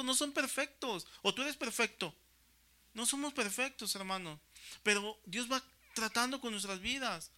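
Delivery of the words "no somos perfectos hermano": ordinary talk, nothing marked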